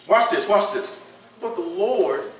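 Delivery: ordinary talk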